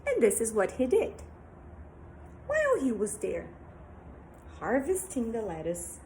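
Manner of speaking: usual speaking voice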